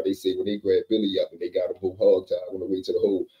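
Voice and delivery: usual speaking voice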